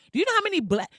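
high-pitched, heated demand; real indignant outburst. Real, not acted. Ranting vlogger calling out racism, indignant. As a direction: fire the question out high, then cut off. Outburst she breaks off and restarts.

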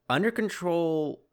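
"Under control" slow, high, heavily ironic; sarcastic air quotes. Shown as a demonstration. Host mocking the ceasefire claim, slow and high, with ironic air quotes. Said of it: "heavy quotations on".

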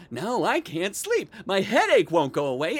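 normal talking voice

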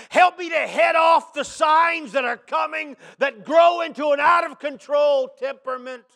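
loud, high prayer building into a shout; fervent preached prayer. Acted. Preacher voicing his prayer for help with rage, shouted. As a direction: pray it out loud with mounting force.

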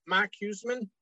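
Boston accent, Mark said as Mahk with no r. Real, not acted. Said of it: "my Boston accent out of there".

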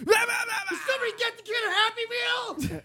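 high screaming yell; Adam Sandler scream. Retold or imitated. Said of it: "Adam Sandler screaming like that".

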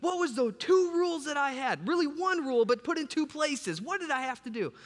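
high, strained panicked voice. Retold or imitated. Boy realizing he broke the window, high panicked self-talk. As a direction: replay the moment dread hits. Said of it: "and I think".

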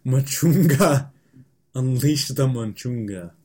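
slow, drawn-out dramatic announcer voice. Pretend. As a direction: stretch out Manchunga like an epic trailer. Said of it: "you said that pretty good".

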